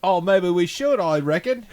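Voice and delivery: loud high Aussie drawl; mock Australian accent. Host takes up the accent dare in mock Australian. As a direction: answer in a hammed-up Australian accent. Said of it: "maybe we should talk in an accent".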